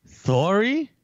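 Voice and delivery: "Sorry?" lisped as "thorry", high and loud; mock lisp. Co-host queries the accent, "Sorry?" with mock lisp. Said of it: "they speak with a lisp".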